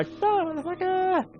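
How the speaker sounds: very high, slow, drawn-out villain taunt; drawled mock threat. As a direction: stretch it out like a cartoon villain. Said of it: "it's impersonating a movie line".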